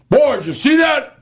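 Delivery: loud, high call; high excited shout; shouting to cellmates. Retold or imitated. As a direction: yell it up the tier. Yelling to cellmates after shooting.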